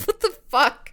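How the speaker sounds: ordinary talk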